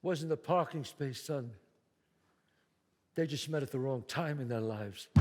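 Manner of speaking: deep, low, calm father; father's low voice. Acted. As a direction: speak low and steady like a tired father. Father answers son.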